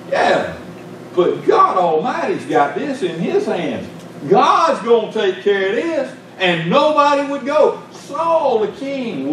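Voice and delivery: high, ringing declaration of faith; impassioned preaching. Real, not acted. Preacher voices David trusting God against the giant, impassioned. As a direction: lift the pitch and proclaim it.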